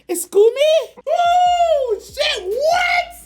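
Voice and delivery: high, loud shocked cry; shocked yelp. Retold or imitated. Retelling his reaction to her text, shocked high yell. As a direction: yelp it out in disbelief.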